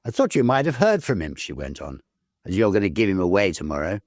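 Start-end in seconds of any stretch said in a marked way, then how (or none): 0.0-1.4 fast nagging sister. Acted. Sister pressing, quickly. 2.5-4.0 fast nagging sister. Acted. Sister pressing, quickly.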